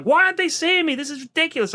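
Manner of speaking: put-on high, whiny child's protest; furious little girl. Six-year-old daughter fed up in the waiting room, high indignant whine. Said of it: "she's just furious".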